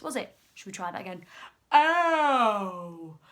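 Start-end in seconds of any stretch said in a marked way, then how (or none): 1.7-3.2 long, loud elephant bellow; big deep voice. A narrator's reading. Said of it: "said Trunky in his big".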